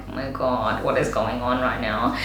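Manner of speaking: very low, hushed aside; muttered dismay. Retold or imitated. Makeup artist sees client's pissed-off face, muttered dismay.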